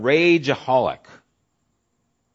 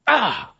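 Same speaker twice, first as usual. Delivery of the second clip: angry outburst cry; 9 semitones higher, 5 dB louder than usual. Shown as a demonstration. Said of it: "I have these outbursts".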